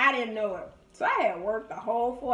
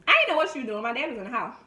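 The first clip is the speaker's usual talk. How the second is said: ordinary talk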